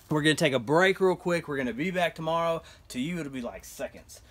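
ordinary talk

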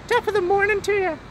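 high stage-Irish greeting; mock Irish lilt; Irish accent.

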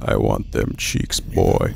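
very low, slow creep voice; softly leering in a deep creaky register; creepy leering. Acted. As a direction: drop it way down, slow and lecherous. Leering bit about onscreen cheeks.

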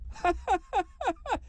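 high, repeated staccato cackle; old man's high-pitched chuckle; cackling laugh. Acted. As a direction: laugh in high, separate bursts. Grandad laughs after his catchphrase.

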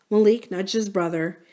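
usual speaking voice

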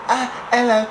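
high-pitched mock squeak; thin little falsetto put-on; squeaky voice. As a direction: imitate a weak, squeaky voice. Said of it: "If you got a squeaky voice".

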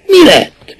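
sudden high, sharp question; a quick high demand. A narrator's reading. Interrogator snaps at suspect.

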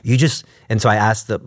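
ordinary talk

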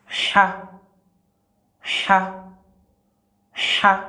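"Cha" said with a smooth, soft breathed h, no throat scrape; smooth soft letter sound. Shown as a demonstration. Teacher drilling students on the smooth soft "Cha". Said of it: "Il y a un son lisse, il y a un son douce".

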